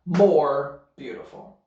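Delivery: ordinary talk, nothing marked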